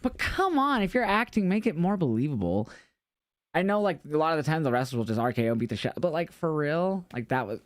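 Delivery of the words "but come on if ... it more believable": exasperated. Real, not acted. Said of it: "complaining about fake security".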